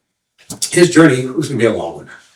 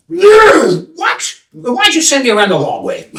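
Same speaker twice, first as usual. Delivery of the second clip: put-on outraged young man; 9 semitones higher, slower than usual. Young man protesting to the seer, high and startled. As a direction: demand an answer.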